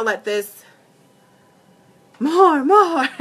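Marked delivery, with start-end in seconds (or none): 2.2-3.1 high, loud put-on voice; comic outburst. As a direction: break off into a playful high cry. Sudden comic outburst mid-instruction.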